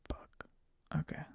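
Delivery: near-silent whisper, resigned; muttered dismay. Retold or imitated. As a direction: barely breathe it out, defeated. Dismayed inner reaction, drunk guy continues.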